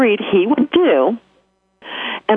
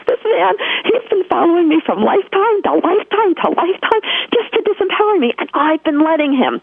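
mock indignation; 12 semitones higher than usual. Mock outrage at a centuries-long pattern.

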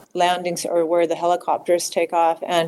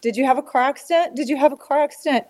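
high, fast, urgent repeated questions; frantic questioning; 6 semitones higher, faster than usual. Retold or imitated. Mother texting her son about an accident, frantic questioning. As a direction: rush the questions out in rising worry.

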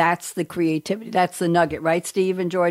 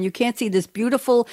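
ordinary delivery

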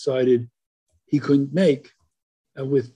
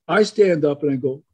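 ordinary talk

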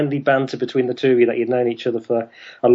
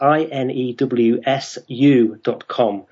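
slow spelling; slower than usual. Shown as a demonstration. Spelling out a web address.

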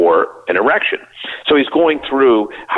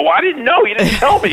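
much higher, indignant self-defence; mock-defensive protest. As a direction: protest your innocence, playing it up. Retells defending himself to Ron.

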